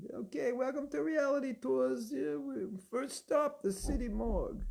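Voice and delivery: put-on high, brash New York tour guide accent, slowed on the first stop. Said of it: "with a New York accent".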